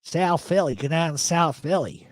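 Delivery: thick South Philly vowels; broad mock South Philly; South Philly accent. Shown as a demonstration. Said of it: "you'd understand".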